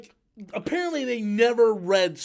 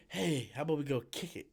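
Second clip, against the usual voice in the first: mocking the actress; 6 semitones lower, 11 dB quieter, faster than usual. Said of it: "It's like".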